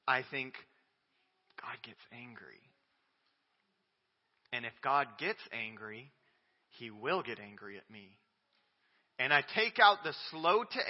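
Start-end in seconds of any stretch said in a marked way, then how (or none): 1.6-8.2 softer, lower worried musing; a hint of anxious self-talk; fearful inner thought. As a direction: voice the old fear quietly. Voicing his own fearful thought.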